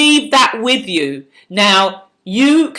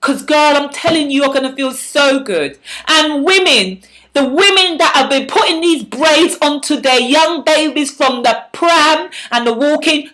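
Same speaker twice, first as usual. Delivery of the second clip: ordinary talk, nothing marked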